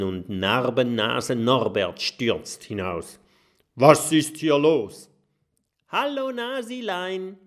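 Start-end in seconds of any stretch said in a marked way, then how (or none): 3.8-5.1 angry captain. A narrator's reading. 5.9-7.4 high, teasing sing-song call; mocking call. A narrator's reading. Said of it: "ruft Kokosnuss".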